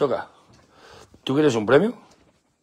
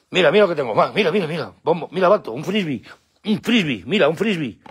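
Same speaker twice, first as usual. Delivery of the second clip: higher, lively coaxing of pets; excited dog talk; 4 semitones higher than usual. Real, not acted. Owner showing his dogs a frisbee, excited. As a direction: get the dogs keen on a toy.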